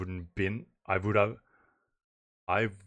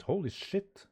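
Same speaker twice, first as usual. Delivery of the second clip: usual speaking voice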